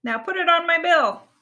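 high, loud duck voice; duck character. A narrator's reading. Duck tells the monkey shopkeeper, in high voice. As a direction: land the punchline loud and high. Duck delivers the joke's punchline.

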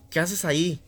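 a mother pulling her son away from the grave; scolding mother. Acted. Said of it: "llegaba la madre y le preguntaba". (madre regañando, firme y cansada)

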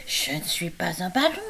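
léger ton indigné; écureuil qui proteste. A narrator's reading. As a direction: protestation vexée. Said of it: "protesta l'écureuil". (l'écureuil proteste, un peu vexé)

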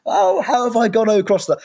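high, loud sudden panic; high-pitched alarm at his own mistake; dismayed realisation. Retold or imitated. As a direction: blurt it out as the penny drops. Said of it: "this moment of thinking".

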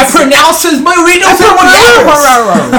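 high, slow pompous priest voice; mock priest. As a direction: pronounce them like a hammed-up priest. Mock wedding vows priest.

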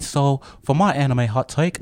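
lightly put-on British accent; mock British accent. Host presenting his hot take in a light British accent. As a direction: say it like a playful Brit. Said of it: "let me switch it to American English".

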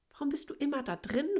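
lightly voiced curious little fish; curious question. A narrator's reading. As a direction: ask like a puzzled child. Curious fish asks friend.

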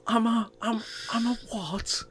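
ordinary talk, nothing marked

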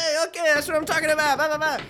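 high, rowdy mock delight; hyped-up chef imitation. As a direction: play the thrilled party chef. Acting out rowdy chef offered beer.

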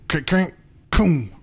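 high slow clanking crash noise; imitated car breaking. Car breaking down at night, clanking imitation. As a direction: mimic the axle snapping. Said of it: "we hear a".

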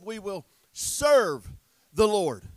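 "serve" drawn out slowly and set apart by pauses; stress on serve. Retold or imitated. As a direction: lean long and hard on "serve". Contrasts serve with worship.

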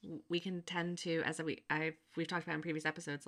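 usual speaking voice

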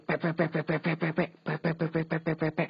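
quick repeated "pe" like message pings; mock notification pings. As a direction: rattle off "pe" like a spammed phone. Imitating spammed chat notifications. (meniru bunyi notifikasi beruntun)

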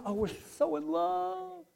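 soft, swooning lovestruck voice; dreamy lovers. Retold or imitated. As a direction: sigh it like a lovesick teenager.

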